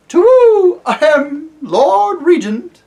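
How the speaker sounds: high, loud hooting owl voice. Acted. Owl to the deaf dwarf, high hooting call. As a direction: hoot the words up at a deaf old dwarf.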